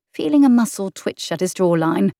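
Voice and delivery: plain reading voice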